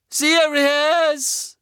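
high, loud, drawn-out cry of a name; anguished call. Acted. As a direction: call after him in despair. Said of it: "rief Harry". (Harry schreit verzweifelt nach Sirius)

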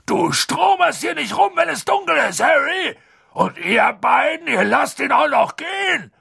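high, strained angry shouting; outraged big-man tirade; furious roar. A narrator's reading. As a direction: bellow a scolding at full tilt. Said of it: "brüllte er so plötzlich los".

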